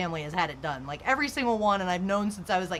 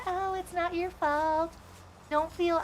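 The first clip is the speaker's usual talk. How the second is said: soothing doctor; 9 semitones higher, slower than usual. Retold or imitated. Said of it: "They said".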